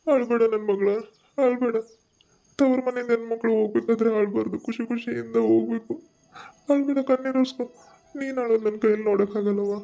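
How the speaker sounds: father crying along, voice pitched high; high, weepy plea to stop crying; tearful consoling. Acted. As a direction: console your daughter while crying yourself. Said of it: "ನೀವು ಅವಳ ಜೋಡಿ ಆಳತ್ತೀರಿ".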